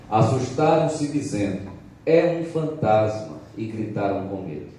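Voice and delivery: loud, rising preacher's reading; preaching at full force. Real, not acted. Evangelist proclaiming the Gospel to the church, loud and rising.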